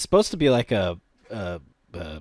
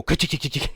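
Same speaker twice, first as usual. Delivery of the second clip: imitated rattle of a falling sword; clinking sword noise; faster than usual. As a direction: rattle off a sword clattering on stone. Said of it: "hear that sword go".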